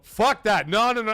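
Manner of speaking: retelling refusing the plane at the terminal; panicked refusal. Retold or imitated. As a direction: back away yelling no. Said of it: "I looked, and I go".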